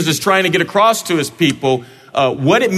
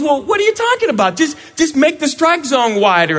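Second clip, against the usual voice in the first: much higher, fast, pleading pitcher voice; whiny pitcher; 10 semitones higher than usual. Acted. Pitcher Brett begging umpire to widen strike zone, high fast whine. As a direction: whine at the umpire to bend the rules.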